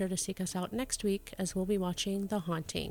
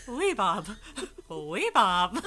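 imitating a confident Wee Bob, louder; louder mock confident delivery; 3 semitones higher, 7 dB louder, much slower than usual. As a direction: say it with mock certainty. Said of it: "said it so confidently".

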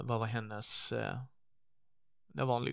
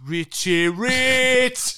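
long, high, drawn-out holler; loud teasing jeer; 12 semitones higher, 11 dB louder, much slower than usual. Acted. Friends teasing Anders about costly whisky, jeering holler. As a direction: crow the nickname, stretching it out.

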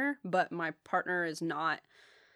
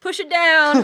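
higher, much louder mock order; mock loud advice; 10 semitones higher, 16 dB louder, slower than usual. Boyfriend tells people to bury feelings, mock loud. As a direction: bark it out like a slogan.

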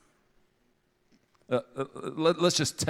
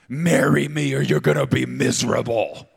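much higher, much louder hammed-up yell; mock threatening proposal; 6 semitones higher, 11 dB louder than usual.

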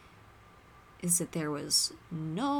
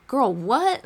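Gloria's sharp disbelieving outburst, a bit louder; incredulous girl what; 4 semitones higher, 6 dB louder than usual. Retold or imitated. Friend to Barbie, baffled she still wants Ken: incredulous burst. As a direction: throw it out like you can't believe her ears.

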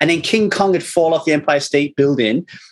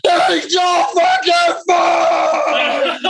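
much higher, slow, laughing shout; yelling into the dirt; 14 semitones higher, much slower than usual. Retold or imitated. Dad venting about his four-year-old's breakfast, slow laughing yell. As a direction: let it all out, dragging every word. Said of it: "And I go".

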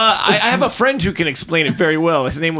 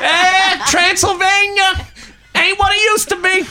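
Bill Burr voice; 13 semitones higher than usual. Pretend. Cohost teased for doing Bill Burr, very high mock whine. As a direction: whine it like a grumpy comic. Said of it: "you've just committed to being Bill Burr".

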